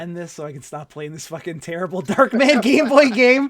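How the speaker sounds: building to a loud, high, half-laughing plea; exasperated plea. Real, not acted. Jokingly begs to stop playing game.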